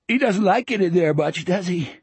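high, mocking character voice with a snicker. A narrator's reading. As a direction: say it as a sly snicker.

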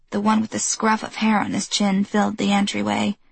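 ordinary delivery

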